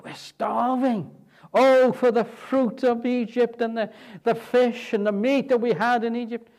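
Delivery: hammed-up longing for Egypt's fish and meat; complaining crowd. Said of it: "the complaint ... about the food".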